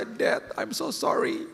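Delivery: put-on high, slow, whispered little child; child's apology. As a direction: whisper sorry like a remorseful kid.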